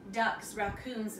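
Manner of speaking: ordinary delivery, nothing marked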